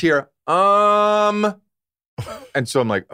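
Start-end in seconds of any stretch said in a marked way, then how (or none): 0.5-1.6 long, high, held um; drawn-out um. Retold or imitated. Houseguest calling the host down, drawn-out um. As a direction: imitate someone calling out with a stretched high um. Imitates cleaner calling him with ums.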